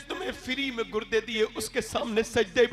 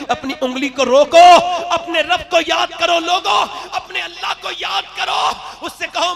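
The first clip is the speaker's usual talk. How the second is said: fervent plea; 6 semitones higher, 10 dB louder than usual. Acted. Exhorting the crowd.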